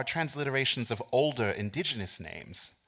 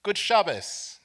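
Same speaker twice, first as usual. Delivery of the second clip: higher, louder cheery greeting across the courtyard; friendly call-out; 9 semitones higher, 7 dB louder, slower than usual. Retold or imitated. Narrator calling a greeting out to the teenage girls, loud and bright. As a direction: call it out warmly to the passing group. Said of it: "I call out to them".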